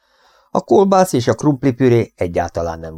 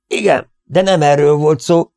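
higher, louder exclamation; shouting; 7 semitones higher, 4 dB louder than usual. A narrator's reading. Boss shouting at his oversleeping brother. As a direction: cut him off with a shout. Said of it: "kiáltotta Siegfried".

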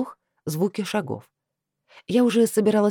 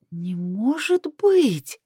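slow, drawn-out, higher-pitched disbelief; shocked gasp; 5 semitones higher, much slower than usual. A narrator's reading. Heroine spots a familiar surname on the list, gasping in shock. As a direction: stretch it out, aghast. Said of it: "ахнула я".

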